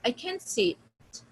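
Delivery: slow "I can't see it" with "see it" run together as see-yit; linked vowels. Shown as a demonstration. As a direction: glide "see" into "it", slowly. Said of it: "So what will you say?".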